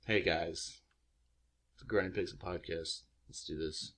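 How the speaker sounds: low, soft, deadpan podcast intro; mumbled, low-energy intro; low lame intro. Pretend. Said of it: "is that seriously how you want me to do it".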